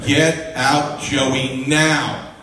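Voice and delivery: loud, commanding divine order; Lion of Judah. Retold or imitated. God ordering Joey out of the party, loud and commanding. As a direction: punch each word out forcefully. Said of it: "I had the Lion of the tribe of Judah in my ear saying".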